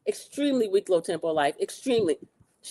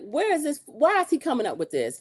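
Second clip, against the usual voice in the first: incredulous; 7 semitones higher than usual. Retold or imitated. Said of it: "I'm like".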